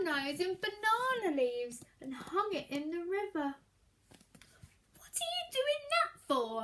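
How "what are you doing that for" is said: much higher curious snake voice. Acted. Snake asking the child about his nose, high voice. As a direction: ask it high and puzzled. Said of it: "asked the snake".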